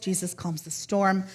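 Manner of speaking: ordinary talk, nothing marked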